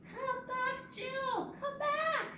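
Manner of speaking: very high playful call; high-pitched beckoning call. Pretend. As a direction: call the bird back in a high voice. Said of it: "Let me hear you".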